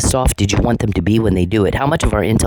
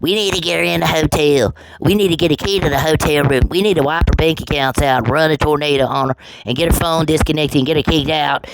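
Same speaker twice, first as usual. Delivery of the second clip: higher-pitched mimicry of the plotters; mocking the men; 6 semitones higher than usual. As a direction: parrot their scheme in a high, put-on voice. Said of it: "the many times you guys said".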